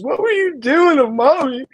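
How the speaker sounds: high imitated toddler asking. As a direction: ask it like a puzzled three-year-old. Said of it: "He goes".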